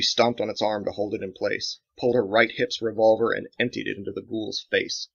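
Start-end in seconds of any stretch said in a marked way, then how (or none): none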